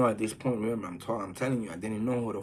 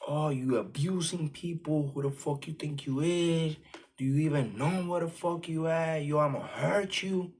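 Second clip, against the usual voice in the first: retold shouting; 6 semitones higher than usual. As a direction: play the drunk guy yelling at you, mock-threatening. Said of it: "he starts just shouting out".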